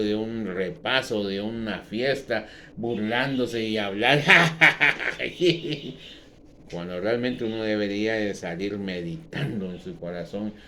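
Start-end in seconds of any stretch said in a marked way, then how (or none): 4.3-6.1 a scoffing laugh, a bit higher and louder; a derisive giggle; mocking laugh. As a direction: laugh like a careless youth mocking. Mocking youths leaving church.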